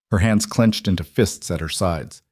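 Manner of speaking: ordinary delivery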